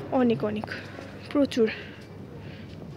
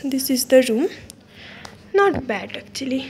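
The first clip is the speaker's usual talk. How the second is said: ordinary talk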